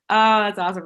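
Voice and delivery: usual speaking voice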